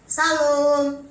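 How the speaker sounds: loud, called-out greeting at a half-open door. Retold or imitated. Visitor calling into a quarrelling couple's house, loud call. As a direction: call it into the house so they hear.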